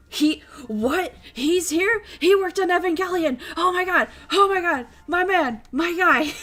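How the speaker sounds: high, breathless-fan gushing; giddy, high-pitched exclamations piling up; super excited. Retold or imitated. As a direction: burst out like a starstruck fan. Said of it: "I was super excited for him".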